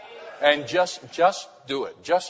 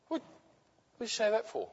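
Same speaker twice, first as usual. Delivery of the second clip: retold surprise; 9 semitones higher, 6 dB quieter than usual. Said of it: "And my mum said".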